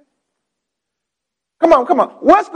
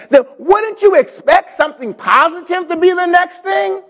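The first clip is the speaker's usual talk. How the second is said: rising challenge; 6 semitones higher than usual. Real, not acted. Preacher raises a challenge.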